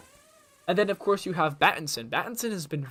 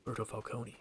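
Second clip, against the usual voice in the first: Jensen Ackles Batman; 5 semitones lower, 13 dB quieter than usual. Retold or imitated. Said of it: "he's always like".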